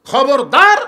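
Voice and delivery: loud, emphatic command; stern warning. Acted. Abu Bakr forbidding anyone to show the grave, loud warning. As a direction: warn them off forcefully.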